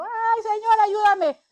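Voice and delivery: high, pleading imitated cry for help; imitated sinner's high plea; mock pleading cry. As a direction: plead like someone crying to God. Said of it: "está uno llorando".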